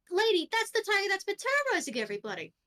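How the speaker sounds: high, fast incredulous protest; incredulous underling. Acted. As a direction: argue back in a high, hurried voice. Underling argues with unimpressed boss.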